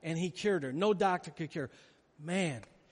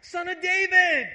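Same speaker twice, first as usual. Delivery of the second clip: high and loud cry; screaming out; 12 semitones higher, 7 dB louder than usual. Shown as a demonstration. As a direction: shout his plea over the crowd. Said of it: "cried out all the more".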